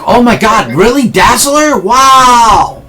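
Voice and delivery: put-on high, gushing kid voice; amazed young kid. Young kid meets Dazzler, high-pitched amazement. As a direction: play a kid awed by a celebrity. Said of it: "young kid over here, he's like".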